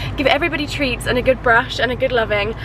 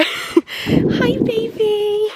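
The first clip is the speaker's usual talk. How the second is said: much higher cooing, laughing; 11 semitones higher, 4 dB louder, much slower than usual. Real, not acted. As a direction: coo a hello. Greeting pony.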